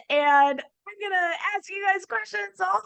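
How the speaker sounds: high, bright, eager plan voice; giddy excitement. Retold or imitated. Wife gushing about road trip games, high excited voice. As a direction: gush like a planner thrilled about questions. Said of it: "in my mind, it was".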